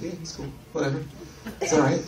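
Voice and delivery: a mock-indifferent fan, barely bothered; imitating a lukewarm fan, quiet and flat; bored shrug. Voicing unimpressed fan.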